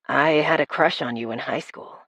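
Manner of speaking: a hint of a low, quiet guy; male love interest. A narrator's reading. Chase's voice in the story.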